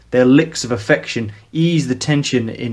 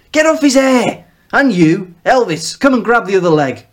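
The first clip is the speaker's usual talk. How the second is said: loud, much higher barked commands; shouted orders; 10 semitones higher, 5 dB louder than usual. Acted. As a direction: yell orders at the brothers. Ordering the brothers around.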